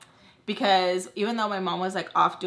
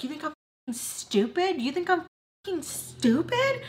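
mock angry mom; 9 semitones higher than usual. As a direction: imitate mom's outrage, pitch climbing high on the repeat. Mother angrily confronting her in retelling.

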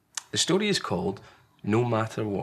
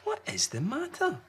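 higher, softer caring question; Large, a loving parent; 3 dB quieter than usual. Acted. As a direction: gently ask what's wrong. Large speaks to Small.

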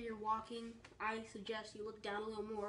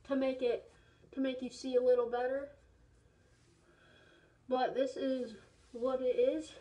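muffled inside full head mask; speaking through a rubber mask; 8 dB louder than usual. Real, not acted. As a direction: voice dampened behind latex. Seen 0: Rubber horse mask covers whole head in every frame while speaking.

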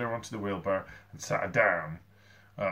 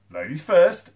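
higher, loud announcement; announcing; 6 semitones higher, 11 dB louder, slower than usual. A narrator's reading. Sid before lifting Gertrude into the wheelbarrow, announcing. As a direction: announce it grandly and loudly. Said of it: "announced Sid".

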